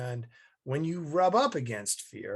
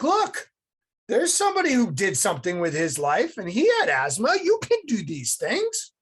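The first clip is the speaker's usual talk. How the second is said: much higher, louder emphatic mom voice; excited mom; 8 semitones higher, 7 dB louder than usual. Retold or imitated. Mom inspires her asthmatic son with Teddy Roosevelt, high and loud. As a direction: fire him up with an example.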